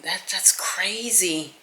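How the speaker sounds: slow, soft whispered aside. Real, not acted. Vlogger whispering about hours-long tattoos. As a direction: hush it like a secret.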